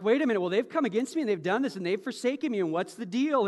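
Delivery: fast, loud mock grievance; indignant complaint. The wronged listener complaining about betrayers, mock indignation. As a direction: rattle off the wrongs as if offended. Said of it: "when you go like".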